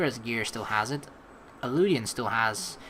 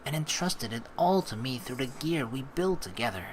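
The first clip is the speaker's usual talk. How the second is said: calm, measured reading of a story; story narration. Acted. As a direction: read it like an inner monologue. Reads the game's story text.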